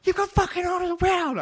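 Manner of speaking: very high, loud shout; furious yell. Retold or imitated. Head chef shouting at the cook by the freezer, furious. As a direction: roar it into the changing room. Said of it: "he's going".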